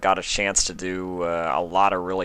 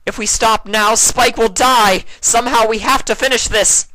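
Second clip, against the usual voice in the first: Luna shouting; 10 dB louder than usual. Acted. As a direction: cry out that you can't stop now. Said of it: "Luna shouted".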